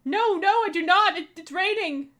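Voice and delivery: high, loud, eager sidekick chatter; excited sidekick voice. Acted. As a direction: play the overeager little hero denying tears. Voicing the Oko character lines.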